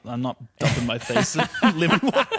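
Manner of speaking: laughing through the denial. Real, not acted. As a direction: chuckle while you protest.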